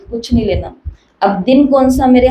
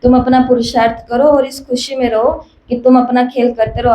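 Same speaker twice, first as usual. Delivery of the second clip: normal talking voice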